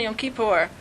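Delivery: ordinary talk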